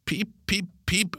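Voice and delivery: very high bird cheeps; calling like a bird. A narrator's reading. Kalle playing bird to tease Pip, high cheeping. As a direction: cheep it out in a high, teasing call. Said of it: "Kalle råber igen".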